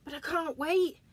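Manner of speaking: high, loud desperate plea; nearly bursting; desperate bear. Acted. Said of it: "said Alan".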